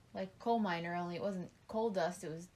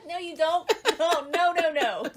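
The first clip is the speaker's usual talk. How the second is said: laughing; 9 semitones higher, 11 dB louder than usual. Real, not acted. Laughing protest at husband's joke.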